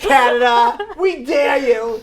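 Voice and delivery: loud slow taunting dare; drawn-out playground dare shout; mock taunt. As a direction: call it out like a challenge. Taunting another country, shouting.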